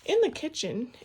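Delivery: usual speaking voice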